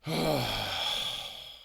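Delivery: long, loud sigh after a tense call; heavy sigh. Acted. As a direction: let out a big, drawn-out breath. Alone after a tense phone call.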